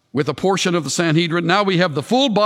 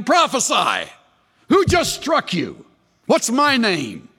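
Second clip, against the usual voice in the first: high, emphatic mocking challenge; acted taunting; 6 semitones higher than usual. Guards beating a blindfolded prisoner, acted taunting. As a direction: jeer at a helpless prisoner.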